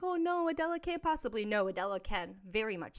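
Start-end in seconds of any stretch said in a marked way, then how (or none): 0.0-1.4 imitating viewer. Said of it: "where you're like".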